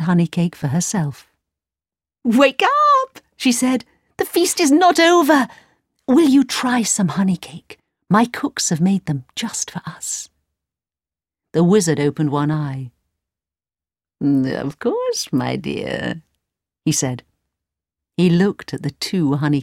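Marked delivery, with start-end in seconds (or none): 2.2-10.3 high, coaxing princess voice. A narrator's reading. 14.2-17.2 wizard voice. A narrator's reading.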